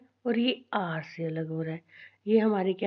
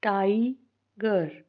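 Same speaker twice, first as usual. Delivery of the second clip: Tiger in two slow parts, टाइ, pause, गर; split into syllables; much slower than usual. Shown as a demonstration. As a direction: break tiger apart with a clear gap. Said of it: "divide करते हैं".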